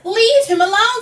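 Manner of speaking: high, loud protective command; commanding shout. A narrator's reading. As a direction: boom it out to protect a friend. Lion roars to defend Meerkat.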